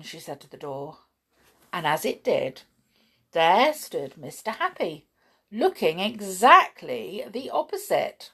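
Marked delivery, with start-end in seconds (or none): none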